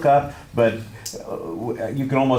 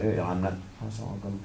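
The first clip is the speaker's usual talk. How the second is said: imitating voice dropping out; 2 semitones lower, 10 dB quieter, much faster than usual. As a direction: let the voice fade away like an unsure speaker. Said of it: "your voice drops out".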